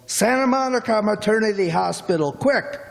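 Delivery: high, rushed mock yell; retold urgent yell. Cabbie retelling a husband rushing into his cab, mock urgent yell. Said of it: "he yells".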